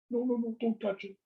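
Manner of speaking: high, quick protective refusal; play-acted alarm; mock protest. Acted refusal to hand over glass.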